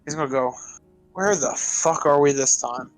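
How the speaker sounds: normal talking voice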